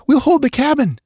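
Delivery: high, urgent woman's voice; settler woman. A narrator's reading. Settler woman urging Balthus to hold the cabin, high. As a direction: plead to stay and fight.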